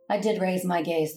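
normal talking voice

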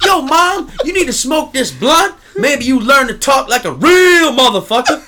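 high, loud put-on thug voice; mock thug voice. Comedian quoting the bad comic's joke, in a loud mock thug voice. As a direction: ham up a gangster son talking to mom. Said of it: "those were jokes he had".

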